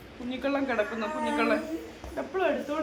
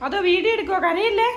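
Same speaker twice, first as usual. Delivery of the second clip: high playful question; 10 semitones higher, 5 dB louder than usual. Real, not acted. Mother asks baby playfully.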